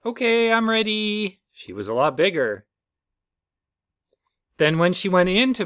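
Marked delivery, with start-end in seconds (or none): 0.1-1.4 put-on much higher, louder little baby voice; baby kangaroo voice. The baby announces she is ready to come out, high and loud. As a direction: squeak it out like a tiny newborn.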